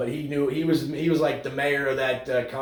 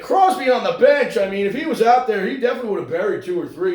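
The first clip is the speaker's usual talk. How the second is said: loud, high excuse-making broadcaster, racing at the end; ranting announcer parody; mock homer announcer; 8 semitones higher, 8 dB louder, faster than usual. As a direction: shout the excuses for the star. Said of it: "they'd be like".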